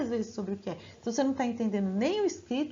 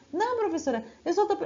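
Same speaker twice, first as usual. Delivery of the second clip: mock student protest; 9 semitones higher than usual. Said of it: "student protest retold".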